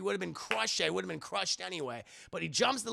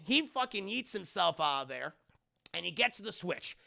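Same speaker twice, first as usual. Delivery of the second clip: usual speaking voice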